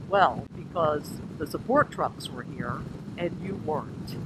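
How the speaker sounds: slow, lightly imitated friend; a hint of someone else's slow, matter-of-fact voice; Brian. Brian explaining his worry, lightly imitated and slow. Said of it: "let me imitate Brian".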